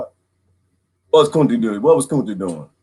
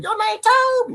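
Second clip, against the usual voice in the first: a hint of a sneering master, pitched up; high taunting question to the captive; mocking question. Master taunting Kunta about his new name, high and mocking. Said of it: "They said".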